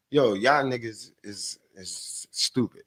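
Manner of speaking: put-on a light African accent, slow and dropping soft. Quoting the African kid's jab at his friends, light African accent. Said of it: "the way he said it with his African accent".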